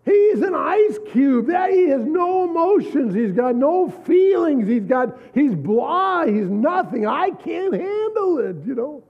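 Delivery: wife venting about her cold husband; shrill exasperated wife. Retold or imitated. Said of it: "What's the problem".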